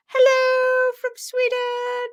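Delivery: lilting high welcome; high drawn-out greeting. Acted. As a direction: stretch it out high and sweet.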